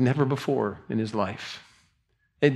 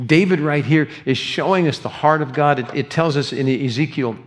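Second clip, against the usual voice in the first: louder, driving pulpit voice; full-force preaching; 3 semitones higher, 6 dB louder than usual. Acted. Pastor to congregation, loud driving preaching.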